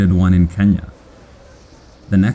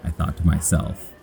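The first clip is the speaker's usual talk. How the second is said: usual speaking voice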